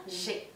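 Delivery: "Je" said with a soft zh and a plain Spanish e; the e in "Je" like a normal Spanish e; French je. Shown as a demonstration. Teacher to Spanish speakers: French je, soft zh, plain e. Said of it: "se pronuncia como una E".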